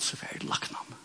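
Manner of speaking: a hushed, private admission; whispered aside. Real, not acted. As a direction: whisper it almost to yourself. Quiet aside in sermon. (hviska for seg sjølv)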